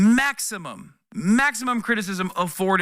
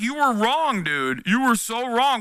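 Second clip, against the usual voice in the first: higher, mock smug gloating; 6 semitones higher, slower than usual. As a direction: rub it in like a know-it-all. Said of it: "come and be like".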